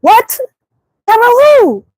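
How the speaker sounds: high, loud "who the fuck is that"; baffled friend snapping back; incredulous retort. Retold or imitated. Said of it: "I'm like".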